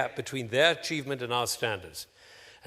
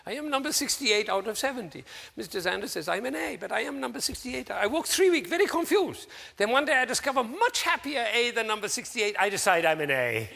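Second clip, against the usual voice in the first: higher, imitated Taiwanese student's English accent; 9 semitones higher than usual. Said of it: "my attempt at his accent".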